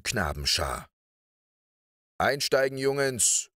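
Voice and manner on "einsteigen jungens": high teacher's call to board; raised, carrying call, short of a yell. A narrator's reading. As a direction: call the boys together. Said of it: "rief er".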